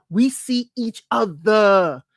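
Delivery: slow, drawn-out, stretched vowels; put-on celebrity impression, each word stretched; Candy Burris. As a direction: draw it out slowly in a playful impersonation. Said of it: "In my Candy Burris, um, voice".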